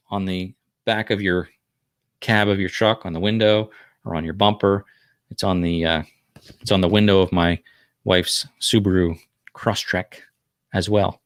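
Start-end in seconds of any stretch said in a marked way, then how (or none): none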